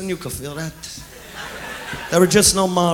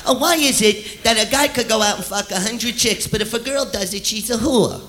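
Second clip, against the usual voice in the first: mocking women; 3 semitones higher, 4 dB quieter than usual. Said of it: "You'll hear them have conversations like".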